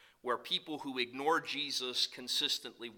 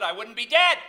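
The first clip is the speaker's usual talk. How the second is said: punchline shout; 11 semitones higher, 18 dB louder than usual. Retold or imitated. Grandfather's joke punchline.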